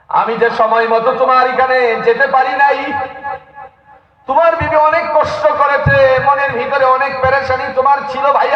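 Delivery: high, ringing sermon delivery; impassioned preaching. Acted. Preacher retelling the leader's apology to his brother, impassioned preaching.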